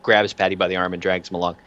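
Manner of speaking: usual speaking voice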